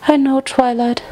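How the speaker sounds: high-pitched, tearful acceptance; Fluttershy, tearful. A narrator's reading. Crying friend answering Twilight, tearful. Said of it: "tears still streaming".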